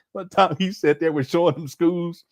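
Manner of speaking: ordinary delivery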